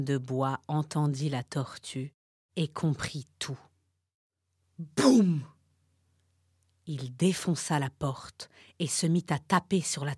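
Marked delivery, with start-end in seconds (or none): 4.8-5.4 loud, punchy boom; boom sound effect. A narrator's reading. Narrator voices the door smashing, loud boom.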